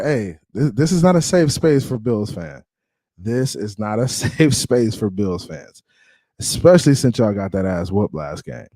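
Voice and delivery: usual speaking voice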